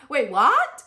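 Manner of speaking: high, loud startled double take; shocked disbelief. Retold or imitated. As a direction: react like you just heard bad news. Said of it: "I'm like".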